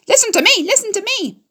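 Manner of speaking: very high, sing-song goose call; honking goose cry. A narrator's reading. Goose urging Wilbur to dodge, high honking cry. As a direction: squawk the orders like a flustered goose. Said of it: "screamed the goose".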